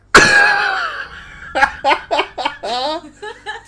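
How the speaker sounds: very high squeaky laugh; high giggle. Real, not acted. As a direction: burst into giggles. Laughing at own clown bit.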